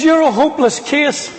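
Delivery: high-pitched mock scolding; a mother's mild mock yell; retold upset shouting. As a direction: lightly imitate an exasperated, high-pitched outburst. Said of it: "That's the way she was shouting".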